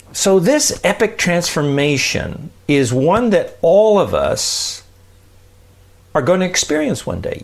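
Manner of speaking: ordinary talk, nothing marked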